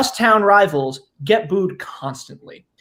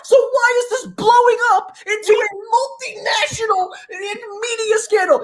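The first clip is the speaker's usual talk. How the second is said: mock outrage; 14 semitones higher than usual.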